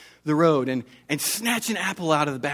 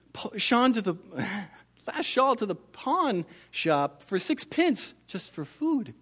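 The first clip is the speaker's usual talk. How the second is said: put-on slow, higher pleading voice of a poor old woman; 6 semitones higher, slower than usual. Old woman's plea, voiced by reader.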